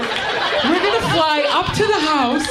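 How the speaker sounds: hammed-up airhead gushing, half sung. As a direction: gush like a spoiled pop star. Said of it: "She's like".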